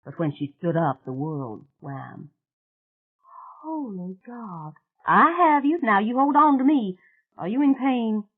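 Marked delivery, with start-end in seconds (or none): 3.6-4.7 slow, choked cry of alarm; a frightened half-sob; alarmed gasp. Acted. Mary Jane alarmed, crying out. 5.1-8.2 much higher and louder, urgent hold-on; urgent rescuer. Acted. Mary Jane catching Mona.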